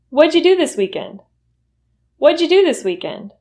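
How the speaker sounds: What'd you said as whaja, pitch lifted; reduced question. Shown as a demonstration. Teacher asking about the weekend, reduced. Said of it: "make sure you're pronouncing the reduction".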